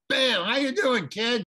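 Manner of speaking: loud fatherly hello with a whack; loud slapping greeting. Shown as a demonstration. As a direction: bellow it like you're smacking an old friend. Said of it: "give him a good friendly slap in the face".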